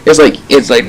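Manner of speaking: ordinary talk, nothing marked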